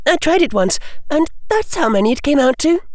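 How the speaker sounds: brisk, chirpy schoolgirl, fast; high quick girl voice. Acted. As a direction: rattle it off cheekily. Hermione voiced.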